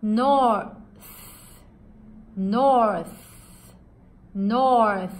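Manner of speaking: North ending on a voiceless th, breath between teeth; th pronunciation demo; TH drill. Shown as a demonstration. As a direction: say North loud with a clear th. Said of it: "じゃあ、北です。".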